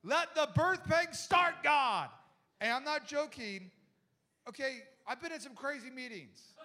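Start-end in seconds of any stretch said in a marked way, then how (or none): 0.0-2.1 loud, slow, commanding proclamation; loud slow prophet's decree; prophetic decree. Retold or imitated. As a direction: call down labor like a prophet. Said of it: "she goes".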